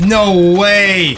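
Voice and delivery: loud disbelieving shout; stunned shout. Real, not acted. Reacting in disbelief.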